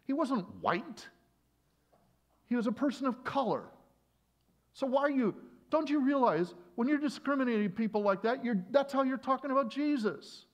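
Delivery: high, loud impassioned tirade; harsh rebuke. Retold or imitated. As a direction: retell a scolding at full shout. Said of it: "that's maybe a harsh way".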